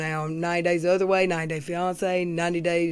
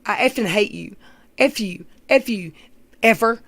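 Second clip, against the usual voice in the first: mock angry girlfriend; 4 dB louder than usual. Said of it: "walk around and say".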